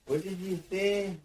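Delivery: high, loud, exasperated ask; frustrated repeat of the question. Retold or imitated. Confused worker asks repeatedly.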